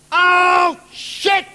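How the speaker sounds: high, drawn-out yell; sudden dismayed cry. Retold or imitated. Friend in Central Park suddenly cursing, high dismayed yell. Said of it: "he suddenly goes".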